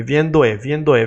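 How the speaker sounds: "viendo e" linked into one rhythmic unit; repeat-after-me linking drill. Shown as a demonstration. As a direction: lead learners through "viendo e", joined up. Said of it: "I want you to repeat with me".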